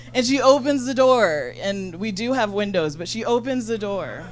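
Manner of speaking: usual speaking voice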